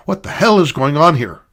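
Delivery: sharp angry repeat. Acted. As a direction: repeat it, losing patience. Woman repeats demand, exasperated.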